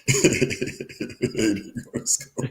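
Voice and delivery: wheezy, voiceless laughter swallowing the words; real laughing. Real, not acted. Host repeats co-host's line about skipping school, laughing. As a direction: repeat it while helplessly cracking up.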